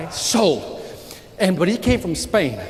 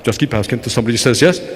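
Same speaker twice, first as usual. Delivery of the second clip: much lower, suave mentor repeating his advice; deep mentor voice; 9 semitones lower, faster than usual. Retold or imitated. Mentor Jose repeating his secret to Victor in a deep voice. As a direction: say it again, deep and unbothered. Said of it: "talk like Ricardo Montalban with this, like, real deep voice".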